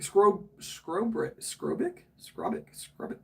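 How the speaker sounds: Scrobrick tried slowly, trailing off; trying out a name. Real, not acted. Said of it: "Am I saying that right?".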